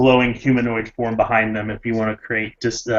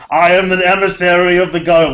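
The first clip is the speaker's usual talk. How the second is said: high, loud booming spirit voice; 8 semitones higher, 7 dB louder than usual. Acted. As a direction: boom it out like a spirit envoy. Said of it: "I really wish that you would have actually".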